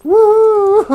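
high, loud whoop breaking into laughter; big triumphant "woo" and laugh. Real, not acted. Player cheers surviving the zombies, whooping laugh.

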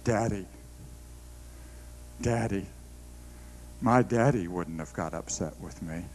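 lightly voiced small daughter, quiet and low; a hint of a child pleading softly; little girl voice. Daughter answering her mother, mildly imitated as a quiet child. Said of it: "She says".

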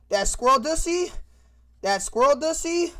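real excited hype. Real, not acted. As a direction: hype it up to chat. Excited at picture.

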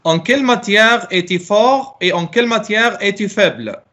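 ordinary talk, nothing marked

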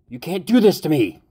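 high, loud pleading shriek; desperate scream. Acted. Victim begging her captor not to kill her, shrieking. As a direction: scream in disbelief and terror. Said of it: "she screamed".

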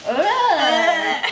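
normal talking voice

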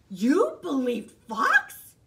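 loud, slow incredulous question. Acted. Hare asking Bear in disbelief, loud and slow. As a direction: stretch it out in disbelief.

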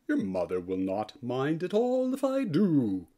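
usual speaking voice

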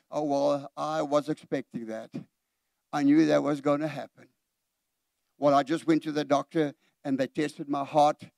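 low, subdued, monotone resignation; resigned negativity. Acted. Preacher imitates a believer expecting bad news, resigned. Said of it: "first little thing that goes wrong".

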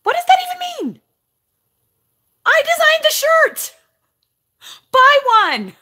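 shouting high and loud in disbelief; exasperated shout. Real, not acted. Vlogger yelling back at a rude commenter, exasperated. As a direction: yell it out, fed up and outraged.

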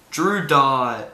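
ordinary talk

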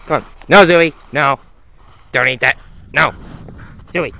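usual speaking voice

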